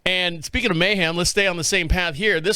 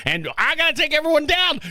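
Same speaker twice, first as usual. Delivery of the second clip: builds from plain to a yell, higher at the end; rising panicked shout; 7 semitones higher than usual. Retold or imitated. Pilot's tripping mind yelling at himself, building to a shout. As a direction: start calm, then blow up into a shout. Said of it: "your mind starts going".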